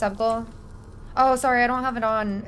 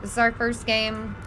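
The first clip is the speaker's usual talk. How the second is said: ordinary talk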